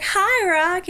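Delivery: high, loud, gushing hello; excited fan greeting. Retold or imitated. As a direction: re-enact a thrilled hello to an idol. Retold greeting to an idol.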